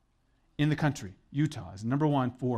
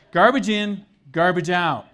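loud, punched and higher; blunt slogan; 4 semitones higher, 9 dB louder, slower than usual. Shown as a demonstration. Preacher restating his inelegant version to the congregation, bluntly. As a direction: hammer out both halves forcefully. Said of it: "This is the way I say it".

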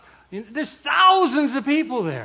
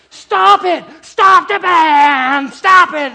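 high, very loud screamed demands, long wail on band; imitated yelling; 9 semitones higher, 16 dB louder, much slower than usual. Imitating angry women shouting at the band, full-force yell. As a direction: holler to halt the music, stretching the middle. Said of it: "And they're just yelling".

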